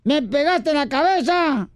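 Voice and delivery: poem recital. Acted. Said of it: "traigo un poema".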